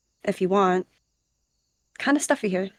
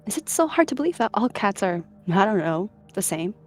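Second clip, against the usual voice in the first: raised pitch, mildly exasperated; mild huff; 4 semitones higher than usual. A narrator's reading. Clan cat defends outsiders to a rogue, mildly huffy. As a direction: question it with a touch of indignation. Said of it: "She huffed".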